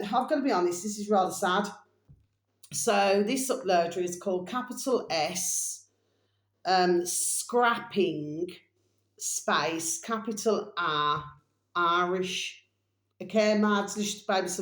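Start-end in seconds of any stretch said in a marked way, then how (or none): 4.5-12.6 very slow dictation with pauses; slow spelling out. Shown as a demonstration. Host spelling an uploader's name for viewers, slowly. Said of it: "I'm gonna have to spell this out for you".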